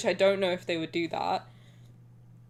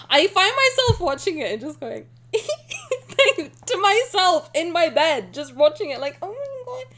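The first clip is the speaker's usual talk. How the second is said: high flustered giggle; giggling; 7 semitones higher, 5 dB louder than usual. Real, not acted. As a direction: giggle shyly. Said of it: "making me shy".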